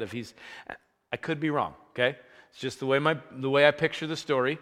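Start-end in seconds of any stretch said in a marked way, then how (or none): none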